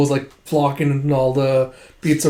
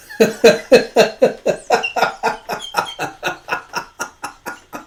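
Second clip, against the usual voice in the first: long, high laugh in bursts; helpless giggling fit; real laughing fit; 10 semitones higher than usual. Real, not acted. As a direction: burst out laughing and let it tail off. Storyteller laughs at the question.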